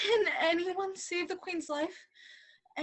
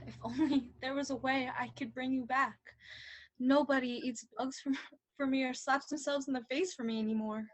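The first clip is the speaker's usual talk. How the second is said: mock mournful; 5 semitones lower, 10 dB quieter, faster than usual. King mourns, sighing softly.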